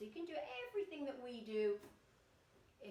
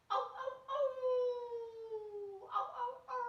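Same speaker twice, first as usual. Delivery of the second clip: loud, high howl with a long tail; wolf howl; 8 dB louder than usual. Pretend. Teacher saying hello as a wolf to children, howling. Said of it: "is a wolf".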